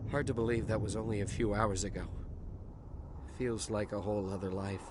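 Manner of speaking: low, subdued, wistful; somber reflection. Acted. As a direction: let the voice sink into numb disbelief. Survivor looking back, weary.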